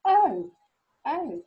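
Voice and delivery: very loud, high shocked "oh!". Acted. Shocked at seeing the goat, for the O action, shocked. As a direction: burst out in exaggerated shock. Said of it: "been very shocked at seeing the goat".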